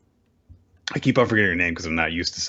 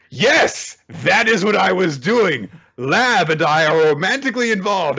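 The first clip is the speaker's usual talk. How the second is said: high, loud hammed-up declaration; overeager playing along; 12 semitones higher, 5 dB louder than usual. Fighter selling a fake romance, loud hammed-up insistence. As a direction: declare it far too emphatically.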